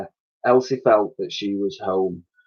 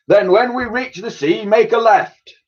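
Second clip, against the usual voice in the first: high, louder put-on admiral voice; admiral's command; 11 semitones higher, 3 dB louder than usual. A narrator's reading. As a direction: give the order like a proud old admiral. Admiral keeps giving orders.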